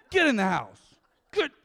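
firm, loud order to a dog; manly command. Retold or imitated. Owner to dog after the squirrel, manly command. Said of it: "And I became manly again".

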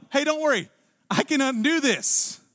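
high, emphatic acted promise; acting out an astonishing promise of help; confident reassurance. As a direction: announce it boldly, pitched well up. Voices Jesus reassuring the grieving.

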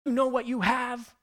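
put-on high, loud snap at the kids; retelling a harsh scold at his daughters; upset scolding. Father scolding his fighting daughters, an upset high loud snap. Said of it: "I got upset with them".

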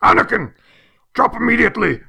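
high, loud movie-hero command; hammed-up Jedi warning shout; Liam Neeson yell. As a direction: bark the order like Liam Neeson in a panic. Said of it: "with different inflections".

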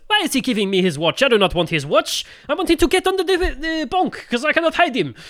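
exaggerated French-accented English, rising into high-pitched protest; ridiculous French accent. Rene protesting about the watch, ridiculous French accent. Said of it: "speaks with a massive, ridiculous accent".

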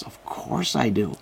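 slow, soft, eager of course; imitating excited believer. Retelling the temple worker's delighted answer, slow and soft.

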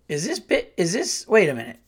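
questions shooting up high, then dropping; mild disbelief. Retold or imitated. Diner puzzled by the waitress's attitude, incredulous. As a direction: stop short in mock bafflement. Retells his baffled inner reaction.

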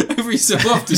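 laughing while talking; words through giggles. Real, not acted. Cracking up mid-story.

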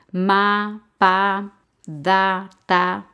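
each syllable said separately and firmly; isolated syllables. Shown as a demonstration. Teacher gives learners examples of syllables, isolated and firm.

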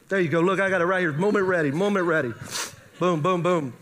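usual speaking voice

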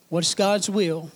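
ordinary delivery

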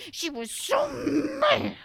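put-on showing the children how mad she was; mock fury. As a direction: squeeze out the anger for the kids. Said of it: "Show me how mad she was".

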